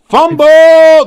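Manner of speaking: real shout at the fumble; excited shout. Real, not acted. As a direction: blurt it out as the ball pops loose. Sudden fumble in live call.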